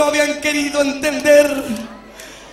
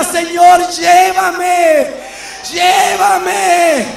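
louder, pleading cry repeated; desperate plea; 2 semitones higher, 4 dB louder, much slower than usual. Retold or imitated. Young man left behind cries out to the Lord, loud pleading. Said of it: "un joven que gritaba y decía".

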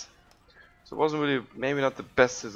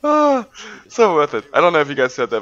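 surprised shout; 4 semitones higher, 10 dB louder than usual. Real, not acted. Sees kill land.

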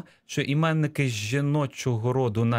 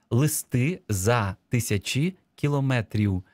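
word by word, with deliberate gaps; slow dictation; slower than usual. Shown as a demonstration. Teacher reading the dictation text back, slow and measured. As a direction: dictate slowly for people writing along. Dictation phrase read out slowly.

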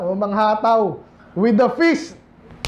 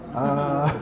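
scoffing laugh. Real, not acted. Scoffing at the excuse.

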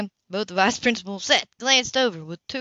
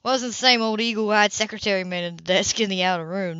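ordinary delivery

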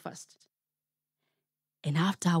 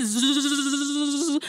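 buzzing zzz like an angry swarm; buzzing bees; 5 semitones higher than usual. Acted. Storyteller buzzing the bees for the kids. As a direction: buzz like the bees. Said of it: "angry swarm of bees".

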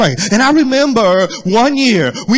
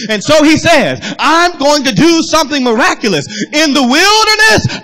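high, ringing proclamation; rising pulpit fervor; full-force preaching; 5 semitones higher than usual. Acted. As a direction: lift the pitch as you declare God's promise.

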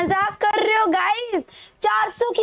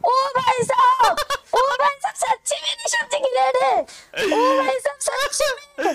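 excited disbelief; 8 semitones higher than usual. Real, not acted. Streamer thrilled by a raid.

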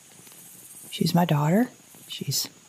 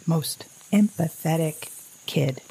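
ordinary talk, nothing marked

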